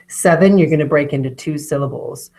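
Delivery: ordinary talk, nothing marked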